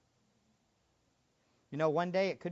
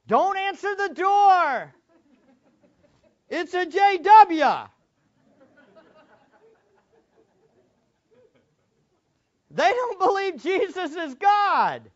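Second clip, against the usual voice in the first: much higher and louder, slow alarm; hammed-up preacher sounding the alarm; mock pulpit alarm about a JW at the door; mock pulpit warning; 14 semitones higher, 14 dB louder, slower than usual. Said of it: "I've heard this so many times from pulpits".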